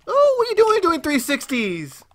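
high, loud shout, slowing at the end; alarmed outcry. Real, not acted. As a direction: yell in startled disbelief. Alarmed outcry at enemy's moves.

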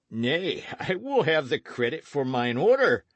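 tipsy slurred outlaw; drunken hiccuping. Acted. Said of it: "he hiccuped".

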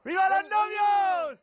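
loud, drawn-out banquet chant; slow, high, hollered cheer; barbaric wedding shouts. Acted. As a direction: bellow like the rowdy friends' table. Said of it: "salen los gritos más bárbaros".

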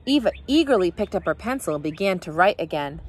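ordinary delivery

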